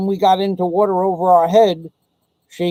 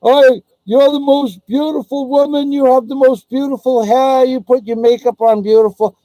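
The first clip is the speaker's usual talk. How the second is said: high, fawning mock flattery; overdone sweet-talker parody; mocking a simp; 7 semitones higher, 4 dB louder than usual. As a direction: sneer through a gushing suitor voice. Mocking FSV flattering a woman.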